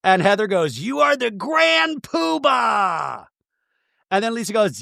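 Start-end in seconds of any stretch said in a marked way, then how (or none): 0.8-3.2 high, drawn-out mock proclamation; mock accusing cry. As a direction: stretch it out like a grand accusation. Retold accusation in a quarrel.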